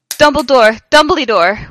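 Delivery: high, loud call for help; yelling. A narrator's reading. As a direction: call out urgently for someone. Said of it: "we both yelled".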